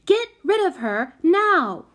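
high, loud, furious command; angry order. A narrator's reading. Prudence demanding Solly deal with the child, angry.

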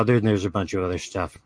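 ordinary talk

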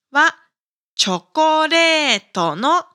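ordinary delivery

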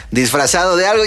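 ordinary talk, nothing marked

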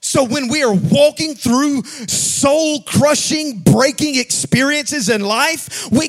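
loud, slow, sung-out preaching cadence; full-force preaching. Acted. Pastor preaching to the congregation, loud sung-out cadence. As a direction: preach it out over the music.

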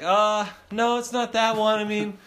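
put-on indignant kid. Kid defending his drawing to dad, mildly hammed-up indignation. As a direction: protest as a put-out child artist.

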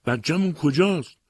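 high-pitched desperate call; crying out. A narrator's reading. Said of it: "بل از پشت سر او فریاد کشید".

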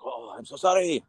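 a hint of a French guy saying sorry; French accent. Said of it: "It's this French guy".

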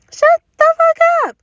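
high, loud shouted retort; mock shout. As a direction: yell it way up, playfully outraged. Mock retort to jealous guys.

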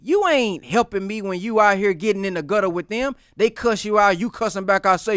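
ordinary talk, nothing marked

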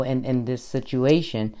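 usual speaking voice

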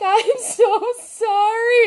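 high, drawn-out retold wail; slow, high-pitched mock-tearful plea; mock crying apology. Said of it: "and I'm crying".

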